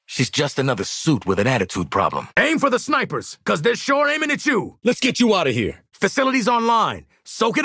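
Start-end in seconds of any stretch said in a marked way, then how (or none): 2.4-4.8 high, urgent battle order; combat callout. Acted. Warning the squad about snipers, high urgent callout. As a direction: call it out over gunfire.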